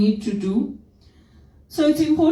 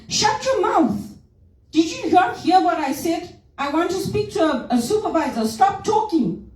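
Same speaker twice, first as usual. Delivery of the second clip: high-pitched, scolding outburst; imitated angry caller; 8 semitones higher than usual. Rude caller to call center agent, angry. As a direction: mimic a furious customer cutting the agent off. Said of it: "That's how they talk to you".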